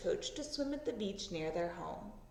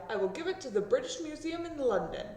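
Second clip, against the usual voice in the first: eager rich man; 6 semitones higher, faster than usual. Acted. Rich man buying the fossil.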